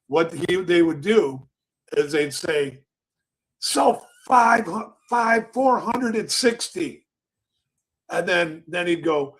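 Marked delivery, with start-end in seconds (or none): 3.6-7.1 high, drawn-out trading-pit call; imitated pit call. Broker calls a sell order to the pit, imitated high holler.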